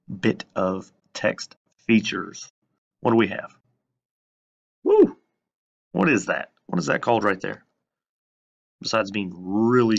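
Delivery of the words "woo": high, loud whoop of delight; sudden playful cheer; excited woo. Real, not acted. Teacher reacts to next slide.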